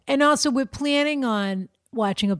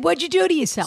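put-on higher Boston accent; 8 semitones higher than usual. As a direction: say it like a Boston local. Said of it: "He said".

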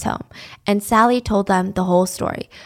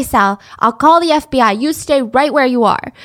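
usual speaking voice

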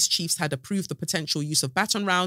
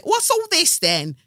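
much higher, louder and slower "what's all this then"; mock shocked; 13 semitones higher, 6 dB louder than usual. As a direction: stretch "all" in mock alarm. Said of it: "And you're like".

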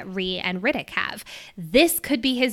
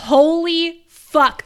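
slow, louder, higher holy fuck; excited exclamation; 6 semitones higher, 7 dB louder, much slower than usual. Real, not acted. Reader gushing about an epic scene, slow and louder. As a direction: savour the awe, drawn out.

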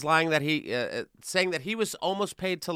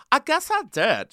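mild put-on higher voice; imitating Anna's admission; 9 semitones higher, 4 dB louder than usual. A host imitating Anna admitting she lied, in a mild put-on voice. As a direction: play her sheepish shrug. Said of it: "she said".

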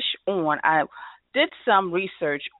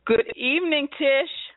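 bright, high welcome; excited greeting; 10 semitones higher than usual. Real, not acted. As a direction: greet her with delight. Greeting arriving guest.

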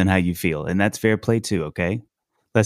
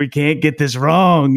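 slow, louder mock worry, half-joking; mock panic; 4 semitones higher, 6 dB louder, slower than usual. Imagining the pressure of picking songs, mock anxious plea. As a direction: drag it out like a nervous selector fretting. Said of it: "I'd just be like".

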